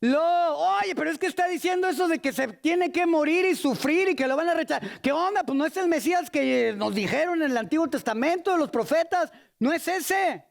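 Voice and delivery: high, agitated objection; indignant protest. Acted. Disciples object that Messiah must not die, high indignant protest.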